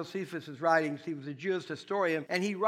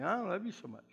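cold spouse; 5 dB quieter, faster than usual. Retold or imitated. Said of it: "how about when you're not so good to me?".

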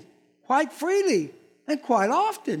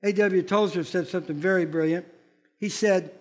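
ordinary talk, nothing marked

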